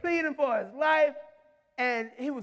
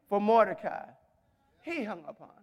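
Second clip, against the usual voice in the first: usual speaking voice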